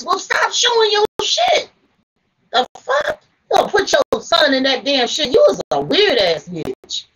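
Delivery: real exasperated telling-off; getting mad. Real, not acted. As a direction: let the anger boil over, scolding. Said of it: "I'm sorry, I done got mad".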